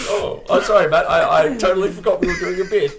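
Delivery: laughing sing-song apology; high, giddy fake sorry; mock apology. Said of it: "I was like".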